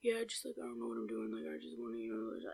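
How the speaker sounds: very soft, barely audible murmur; mock inaudible date talk; imitated mumbling. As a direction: mumble so nobody can make it out. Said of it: "trying to hear what the fuck he's saying".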